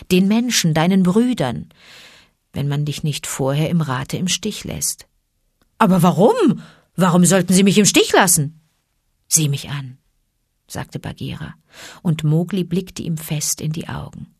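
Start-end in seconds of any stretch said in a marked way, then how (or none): 5.8-8.6 loud, quick worried question; raised, anxious disbelief; alarmed. A narrator's reading. Mowgli alarmed questions Bagheera.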